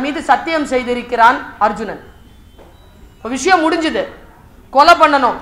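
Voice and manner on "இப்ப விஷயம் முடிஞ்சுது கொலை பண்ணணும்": ordinary delivery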